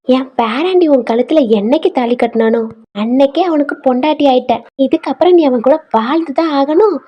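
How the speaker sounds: forceful, emphatic lecture; stern grandmother scolding. Acted. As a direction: lay down the law as the family elder. Grandmother lays down the law. (பாட்டி கண்டிப்பாக அதட்டி அறிவுரை சொல்வது)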